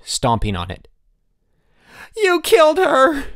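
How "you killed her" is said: high, loud, slow shriek. A narrator's reading. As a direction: shriek in horror. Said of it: "Elmer shrieked".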